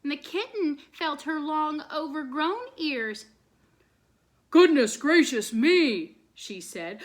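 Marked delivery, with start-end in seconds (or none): 4.5-6.2 loud, slow, big bark of a voice. A narrator's reading. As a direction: boom it out like a great big bark. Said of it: "a terrible, great big bark".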